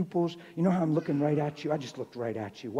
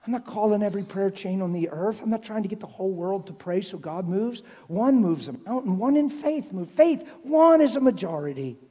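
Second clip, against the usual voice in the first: raised, loud, building proclamation; fervent preaching; 6 semitones higher, 9 dB louder than usual. Real, not acted. Preacher insists one person in faith moves God, fervently. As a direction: build to a high, ringing declaration.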